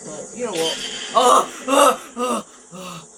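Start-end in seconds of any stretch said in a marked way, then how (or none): 1.2-2.5 loud high frightened screams; startled screams. Real, not acted. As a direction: scream at the scare. Jump scare in game.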